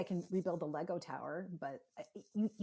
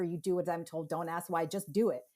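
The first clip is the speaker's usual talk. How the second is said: ordinary talk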